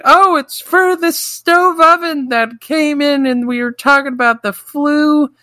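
high, sing-song aunt voice. Retold or imitated. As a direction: chirp it like a sweet old aunt. Voicing the aunts.

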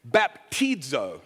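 baptizo said slowly, bap-TEED-zo; careful demo of baptizo with a long "ee"; Greek pronunciation. Shown as a demonstration. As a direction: sound out baptizo the Greek way, stretching the middle. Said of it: "is how you pronounce this".

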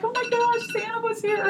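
put-on high, wondering child voice; amazed kids. Kids tell dad Santa came, high and amazed. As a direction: marvel like a small child.

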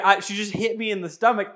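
ordinary talk, nothing marked

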